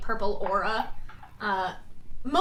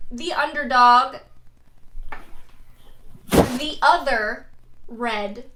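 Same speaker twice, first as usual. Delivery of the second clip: ring announcer; 5 dB louder than usual. Acted. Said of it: "Wade is then at that point".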